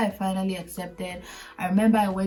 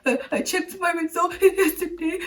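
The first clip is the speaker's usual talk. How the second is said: hammed-up high wailing through laughs; mock sobbing, words broken up by gasps; imitated bawling; 10 semitones higher than usual. Intern re-enacts breaking down to the lawyer, hammed-up bawling. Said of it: "bawling. I was like".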